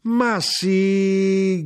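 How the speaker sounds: high, loud, long-held exclamation; emphatic cry. A narrator's reading. Mother insists to her son, crying out. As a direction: cry it out and hold the note. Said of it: "gridò mia madre".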